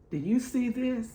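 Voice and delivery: ordinary delivery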